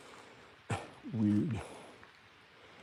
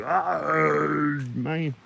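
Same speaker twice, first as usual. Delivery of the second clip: long drawn-out groaned oh; lightly voiced giant sound; Iron Giant sounds; 4 semitones higher than usual. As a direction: imitate a recorded movie-giant groan. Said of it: "he made some sounds for the Iron Giant".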